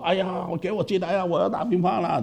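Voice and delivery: high, light classmate calling to be let in; calling over a fence. Retold or imitated. (高声在门外喊)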